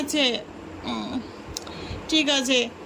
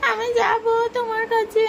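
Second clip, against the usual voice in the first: put-on childlike voice; 7 semitones higher than usual. Child voice, much higher.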